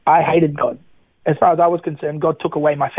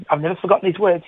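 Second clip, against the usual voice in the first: ordinary talk, nothing marked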